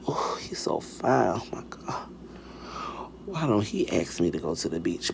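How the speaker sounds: hushed, breathy swoon to oneself; very soft, whispered longing. Retold or imitated. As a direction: whisper it like a secret crush. Swooning private thoughts about crush.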